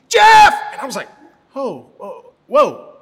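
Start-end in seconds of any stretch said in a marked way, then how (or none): 0.1-0.5 very loud, high shout cutting in; imitated yell. Coworker's yell breaks into his prayer, high startling shout. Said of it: "She just yelled his name". 1.5-3.0 retold startlement. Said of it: "it was so startling".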